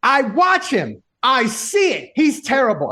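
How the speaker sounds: wife dismissing baseball stats, high and heated; imitating wife. Husband mimics his wife waving off stats, high indignant rant. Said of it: "She's like".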